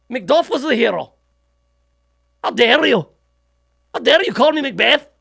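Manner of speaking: high, indignant Scottish outburst; Scottish accent. Acted. Offended Scot objects to being called Macbeth, in Scottish accent. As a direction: get into it with an outraged put-on Scottish accent. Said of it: "I get my Scottish accent from Scrooge McDuck".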